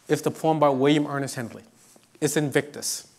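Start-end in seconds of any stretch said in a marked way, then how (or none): none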